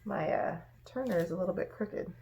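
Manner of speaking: ordinary delivery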